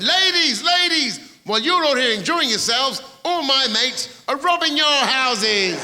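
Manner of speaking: high, loud crowd-hailing shout; rowdy announcement. Acted. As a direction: call out over a room of party guests. Opening line called out to party.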